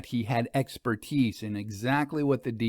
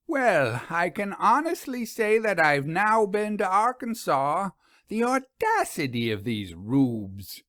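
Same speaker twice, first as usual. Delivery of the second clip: indignant; 7 semitones higher than usual. A narrator's reading. Vera indignant after insult.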